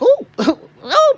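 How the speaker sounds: high joyful laughing whoop; overjoyed hoot up high; laughing cry. Real, not acted. Overjoyed laughing whoop at the thought of it.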